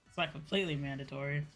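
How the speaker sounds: ordinary delivery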